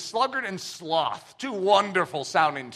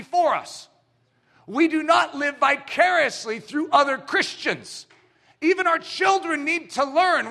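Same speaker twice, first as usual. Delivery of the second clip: full-force preaching; 6 semitones higher, 3 dB louder than usual. Acted. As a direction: preach it hard to the congregation. Preaching climax on obedience.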